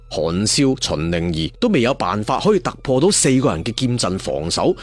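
ordinary delivery, nothing marked

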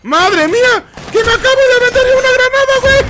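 high, fast shocked yell. Real, not acted. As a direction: shriek at your own blunder. Just grenaded himself.

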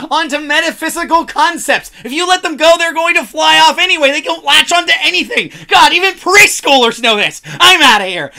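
loud, high, fast tirade; fed up and storming off; angry rant. Acted. Heated argument over the ad.

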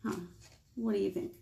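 normal talking voice

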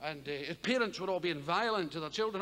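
ordinary delivery

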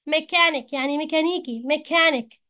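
ordinary delivery

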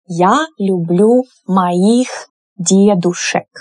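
slow, careful model sentence. Shown as a demonstration. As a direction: say it slowly for learners to copy. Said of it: "teacher models sentence".

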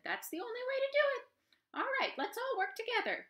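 high, quick, eager mouse voice; chirpy little mouse explaining; clever mouse. Acted. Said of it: "The mouse said".

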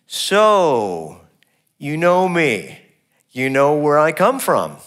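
slow sneering "you know me"; biting sarcasm. Shown as a demonstration. Jesus mocking his opponents, with biting sarcasm. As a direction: drip sarcasm on every word. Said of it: "some biting sarcasm here".

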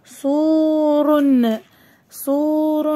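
very slow, stretched vowels; each long vowel held; drawn-out word reading. Shown as a demonstration. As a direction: sound the word out slowly for young pupils. Teacher sounds out word for pupils.

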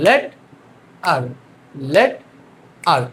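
"লেড", long pause, then "আর"; syllable by syllable. Shown as a demonstration. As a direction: break it into "লেড" and "আর", chanted for learners. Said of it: "আমরা ভেঙে ভেঙে". ("লেড", "আর" ভেঙে ভেঙে, থেমে থেমে)

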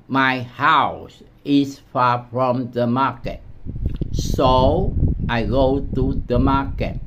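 slow, careful "my house is far from the market"; slow model reading. Shown as a demonstration. Teacher modeling the sentence slowly for students. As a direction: read it slowly so learners can follow. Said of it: "model reading after explanation".

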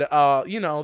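ordinary delivery

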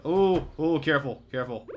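loud, urgent caution; tense excitement. Real, not acted. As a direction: urge yourself to be careful. Close call in game.

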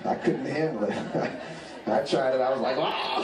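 high-pitched, breaking up with laughter; laughing while talking. Real, not acted. Comic confessing a bad weed trip to the audience, laughing. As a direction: tell it through helpless real laughter. Cracks up telling weed story.

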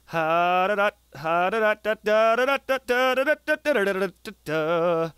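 long rapid-fire "da-da-da" run; rolling tongue-rattle noise; rattled nonsense syllables. Real, not acted. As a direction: stutter out a machine-like roll. Rattles off nonsense syllables while playing.

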